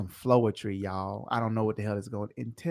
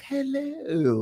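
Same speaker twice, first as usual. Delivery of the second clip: much higher, sweet, overly polite greeting; customer service voice; 14 semitones higher than usual. Shown as a demonstration. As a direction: greet them with fake extra kindness. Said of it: "you kill 'em with extra kindness".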